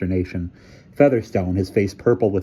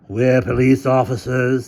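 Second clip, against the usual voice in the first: character voice; 5 semitones higher, 4 dB louder, slower than usual. A narrator's reading.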